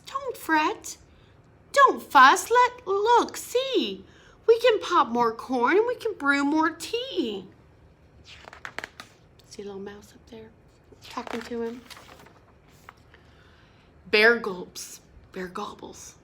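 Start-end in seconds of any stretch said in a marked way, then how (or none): 0.1-7.5 high squeaky little mouse voice. Acted. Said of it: "Mouse squeaks". 9.5-11.8 whispered aside. Real, not acted. Aside to children.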